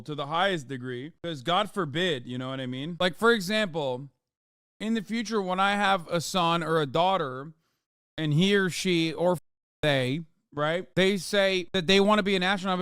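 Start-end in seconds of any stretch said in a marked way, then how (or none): none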